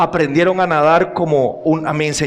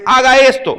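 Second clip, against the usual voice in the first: mock command; 6 semitones higher, 5 dB louder than usual. Parent command imitated.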